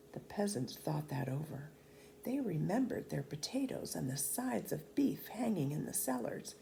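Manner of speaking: whispered, secretive narration; hushed whisper. A narrator's reading. Narrator telling how the peasants plot to fetch food, whispered. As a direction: let the peasants' plan slip out in a whisper.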